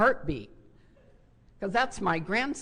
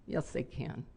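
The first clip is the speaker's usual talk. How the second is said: whispered aside; a knowing whispered reply; 5 semitones lower, 9 dB quieter than usual. Real, not acted. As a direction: breathe it out, unvoiced and low. Mother rebutting daughter's claim.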